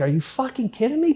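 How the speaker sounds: high, incredulous "are you kidding me". Retold or imitated. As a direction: shoot the pitch way up in mock disbelief. Guard quoted, incredulous.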